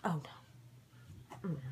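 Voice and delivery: hushed unvoiced dread; whisper. Real, not acted. Reacting to the spirit box saying help, spooked whisper. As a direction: breathe it out, spooked.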